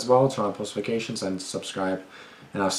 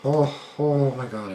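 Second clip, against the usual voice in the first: high, drawn-out spooked reaction; creeped out, stretch the words slowly upward; slow, raised gasp of dread; real fright; 6 semitones higher, slower than usual. Real, not acted. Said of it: "fucking terrified".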